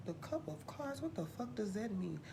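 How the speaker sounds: softly puzzled mutter to herself; muttered aside. Acted. Host questioning her own card name, muttered quietly. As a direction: drop it under your breath, confused.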